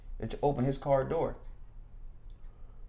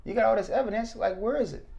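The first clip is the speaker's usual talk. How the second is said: higher, daring come on show me; a touch of bravado; defiant challenge; 8 semitones higher than usual. Retold or imitated. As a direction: taunt the state to bring it. Defies the prosecution.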